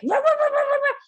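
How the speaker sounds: high, loud yappy barks like a small dog; imitated dog barking. Owner barking back at her barking dog, loud high yaps. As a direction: bark it out like a dog fed up at the door. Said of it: "so I start barking".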